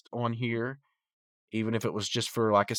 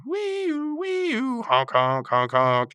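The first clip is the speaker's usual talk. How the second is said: siren wee-oo jumping way higher, then loud honks; cartoon siren whoop, then honking louder; mock truck noise; 13 semitones higher, 3 dB louder, much slower than usual. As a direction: play a toy truck with your voice. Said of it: "starts to make, like, a truck noise".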